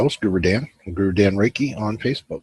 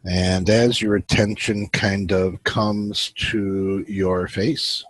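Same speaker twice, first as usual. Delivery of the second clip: guided meditation; 3 dB quieter than usual. Acted. Host guiding his viewers into relaxation, calm guided meditation. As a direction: ease the listeners into stillness.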